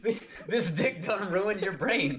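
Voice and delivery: mocking the book's smug hero, laughing; mock macho gloating. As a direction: ham up a smug stud through laughter.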